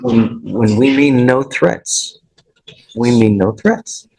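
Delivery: slow, deliberate, leaning on mean; firm emphasis. Real, not acted. Judge warns the defendant about threats, slow and emphatic. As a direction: spell it out word by word so it sinks in.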